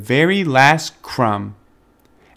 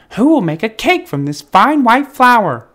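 high, louder hen voice; hen asking; 9 semitones higher, 6 dB louder than usual. A narrator's reading. The hen asking who will bake, high and loud. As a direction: ask the lazy animals for help. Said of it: "asked the little red hen".

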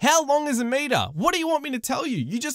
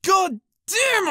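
loud fed-up shout; exasperated outburst; 8 semitones higher, 5 dB louder than usual. Retold or imitated. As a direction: blurt it out loud in frustration. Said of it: "I think to myself".

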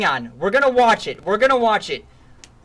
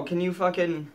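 normal talking voice

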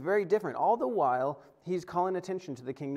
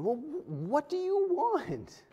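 exasperated; 12 semitones higher, slower than usual. Retold or imitated. Exasperated rhetorical challenge.

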